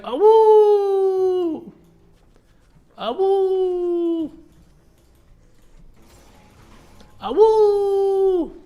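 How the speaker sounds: high, loud repeated wolf howls; howling like a wolf pup. Pretend. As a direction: howl three times like a wolf. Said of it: "I'm like".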